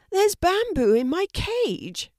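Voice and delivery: high, slow red panda reply; red panda character. Acted. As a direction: draw it out high as the animal character. Red panda answers Kelty.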